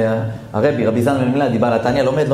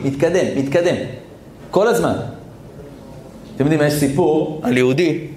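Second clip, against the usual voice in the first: ordinary talk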